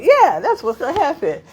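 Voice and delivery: high, loud breezy yes; breezy confident. Retold or imitated. Jumper confidently agreeing with instructor. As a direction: breeze through it.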